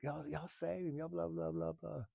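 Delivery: mild mock of nagging onlookers; mocking the crowd. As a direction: mimic their demands with contempt. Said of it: "I just snapped".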